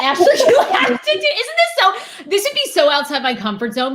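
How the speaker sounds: high-pitched laughing protest that settles down; laughing, flustered. Real, not acted. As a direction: talk through real giggles. Laughing, flustered reaction to the advice.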